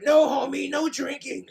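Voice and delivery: high scolding wife voice; cartoon wife. Wife forbids Homie drinking, high scolding voice. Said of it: "My Simpsons voices".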